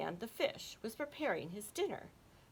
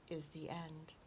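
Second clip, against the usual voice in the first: plain reading voice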